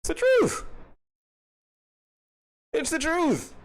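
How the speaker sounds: very high, squeaky put-on voice; tiny high voice stating the truth. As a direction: squeak it out like a cartoon. Squeaky repeated punchline.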